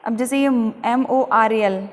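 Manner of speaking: ordinary talk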